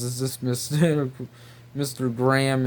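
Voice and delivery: ordinary delivery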